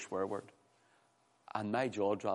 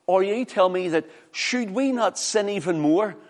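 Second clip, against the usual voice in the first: high, loud, impassioned challenge; full-force preaching; 7 semitones higher, 8 dB louder than usual. Real, not acted. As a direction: proclaim and question from the pulpit.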